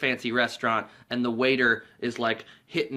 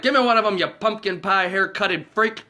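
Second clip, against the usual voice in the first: angry demand; 7 semitones higher, 6 dB louder than usual. Retold or imitated. Cop demanding a beer, mock furious. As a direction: bark the insult.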